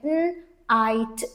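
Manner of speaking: "n, आइट" as two separate sounds; sounding out. Shown as a demonstration. Teacher shows the IGH sound, sounding out "n, आइट". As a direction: hum the "n" alone, then say "आइट". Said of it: "आईजीएच says one vowel sound I".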